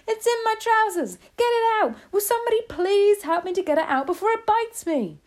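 high-pitched panicked hollering; shouting. A narrator's reading. Cook afraid the mouse will bite, shouting. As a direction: beg for help in loud, high panic. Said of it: "the stupid cook still shouting".

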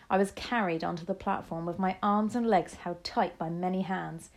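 ordinary delivery, nothing marked

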